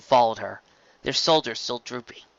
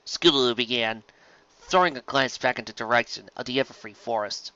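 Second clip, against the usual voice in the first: usual speaking voice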